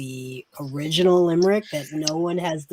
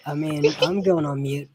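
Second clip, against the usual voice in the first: usual speaking voice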